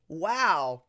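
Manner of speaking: usual speaking voice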